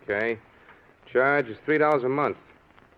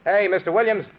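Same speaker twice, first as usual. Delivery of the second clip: calling out; 8 semitones higher, 5 dB louder than usual. Acted. Clerk calls after departing customer.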